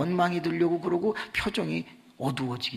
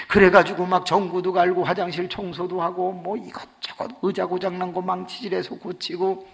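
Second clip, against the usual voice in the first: normal talking voice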